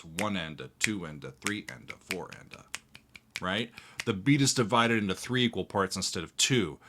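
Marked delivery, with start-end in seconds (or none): 0.0-3.4 quiet demo of a triplet count. Shown as a demonstration. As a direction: count it out softly, each beat split in three. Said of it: "it's triplets".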